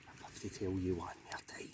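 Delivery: slow, low, hushed telling-off; mock warning whisper. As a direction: whisper a jokey final warning. Mock telling-off about stealing pictures.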